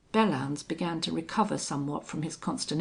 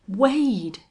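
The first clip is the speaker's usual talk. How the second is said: sudden louder cry of recognition; shocked exclamation; 5 semitones higher, 8 dB louder than usual. A narrator's reading. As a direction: blurt the name out in shock. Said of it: "he ejaculated".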